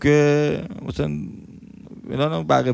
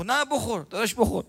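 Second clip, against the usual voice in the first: high, soft pushy coaxing; insisting vendor; 8 semitones higher, 5 dB quieter, faster than usual. Retold or imitated. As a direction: urge him to eat, high and quiet. Vendor insisting before closing. (اصرار ملایم و زیر فروشنده)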